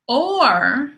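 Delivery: "or" drawn out in a swooping tune, set apart by pauses; stress on OR. Shown as a demonstration. Teacher marking the key word, "or" long and emphatic. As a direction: stretch "or" and let it hang alone. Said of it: "that's the key word".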